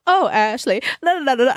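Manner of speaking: sing-song fake posh accent, playing sophisticated; mock posh accent at a bar. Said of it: "I started talking in my accent".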